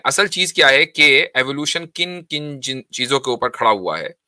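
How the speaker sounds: ordinary talk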